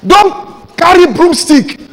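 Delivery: high, loud, slow command; emphatic shout. Real, not acted. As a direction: drive each word home. Preacher forbids broomstick.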